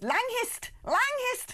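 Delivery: high-pitched mock Northeastern Langhist; put-on Northeastern lilt, LANG-hist; Northeastern accent. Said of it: "That's proper Northeastern".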